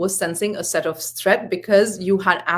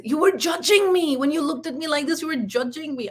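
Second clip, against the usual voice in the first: high-pitched mock-indignant accusation; accusing; 7 semitones higher than usual. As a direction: mimic an aggrieved son confronting his mother. Son accuses mother, retold quarrel.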